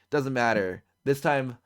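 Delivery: ordinary delivery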